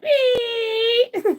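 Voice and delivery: high shrieking laugh. Real, not acted. Friends laughing at her card reading, she shrieks. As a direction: squeal with laughter.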